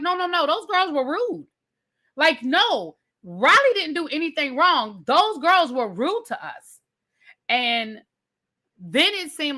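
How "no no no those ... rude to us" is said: higher, insistent defense; defending her friend. Retold or imitated. Georgia defending Riley to Charlie, pitched higher and insistent. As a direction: back her friend up emphatically.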